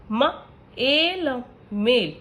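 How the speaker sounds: slow blending with pauses between sounds; sounding out beat. Shown as a demonstration. Teacher giving long vowel examples, sound by sound. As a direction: say ब, ई, ड separately, then blend them into बीट with a long ई. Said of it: "For example".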